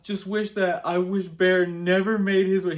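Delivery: high, loud, slow pleading; desperate. Retold or imitated. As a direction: spell it out desperately. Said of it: "He's like".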